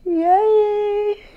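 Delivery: high, loud happy yay; real cheer. Real, not acted. Cheering at gift.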